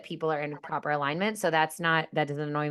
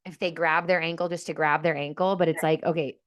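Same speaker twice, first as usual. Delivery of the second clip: ordinary talk, nothing marked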